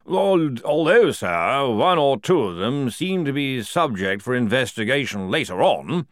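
loud, high-pitched officer; hesitant colonel. Acted. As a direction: interject formally after a stammered start. Colonel voiced in story.

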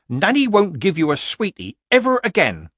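loud, high, cross threat; scolding grandmother. A narrator's reading. Grandmother ordering her grandchild to shut up, scolding.

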